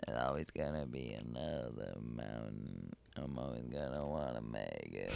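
very low gravelly croak; wrecked smoker voice. Pretend. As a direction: sing like a ruined smoker. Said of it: "how y'all are gonna sound in twenty fifty because of the vapes".

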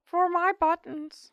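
high, slow, loud squeaky voice; tiny hero battle cry. Acted. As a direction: heroic cookie cry. Gingerbread Man battle cry.

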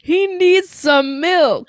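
high, very slow joke line through laughter; laughing. Real, not acted. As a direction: wheeze it out mid-laugh.

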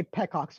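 "pecocks" said PECK-ocks; PECK-ocks instead of peacocks; deliberate mispronunciation. Shown as a demonstration. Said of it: "I say pecocks".

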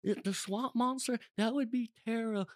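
high-pitched mock London accent. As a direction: put on a hammed-up Londoner accent. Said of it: "I know from London, they're like".

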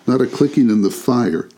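ordinary delivery, nothing marked

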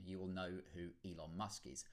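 ordinary delivery

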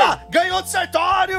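high, excited victory call; excited shout. Real, not acted. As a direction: cry out the surprise win. Announcing the new winner.

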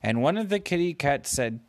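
plain reading voice

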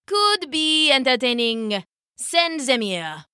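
lordly king voice, unhurried; smug royal voice; bored king. Acted.

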